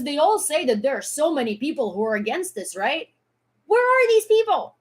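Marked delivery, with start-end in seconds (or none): 3.7-4.8 high, loud, furious demand; real outrage, much higher and louder; outraged yell. Real, not acted.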